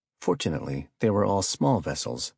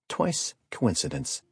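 normal talking voice

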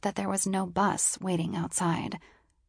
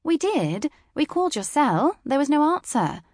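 British woman voice; 9 semitones higher, 5 dB louder than usual. A narrator's reading.

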